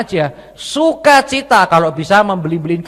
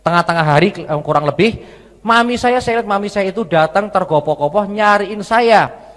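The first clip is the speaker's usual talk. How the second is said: ordinary talk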